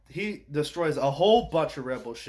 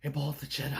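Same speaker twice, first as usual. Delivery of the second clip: hushed quote. Retold or imitated. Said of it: "and Rey says".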